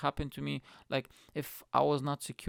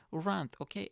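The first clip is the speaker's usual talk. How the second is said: ordinary delivery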